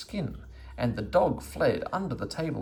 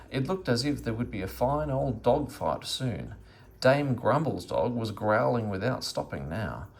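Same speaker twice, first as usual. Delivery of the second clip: usual speaking voice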